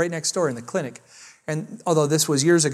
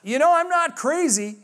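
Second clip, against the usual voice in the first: high-pitched mock yell across a room; re-enacted calling across the pharmacy; retold yell; 9 semitones higher than usual. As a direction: call it out high, without full volume. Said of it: "So I'm yelling across the pharmacy".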